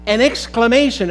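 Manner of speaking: ordinary delivery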